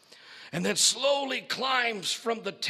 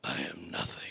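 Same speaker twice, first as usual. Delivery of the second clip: hushed final words; whisper; 7 dB quieter, faster than usual. Acted. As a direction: breathe it out unvoiced. Beast drops to whisper.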